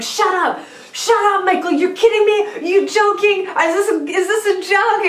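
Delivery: high, loud squeals of disbelief; thrilled high-pitched yelling at your brother on the phone; excited disbelief. Retold or imitated. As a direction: shriek it like you can't believe the news. Disbelieving reaction to being featured.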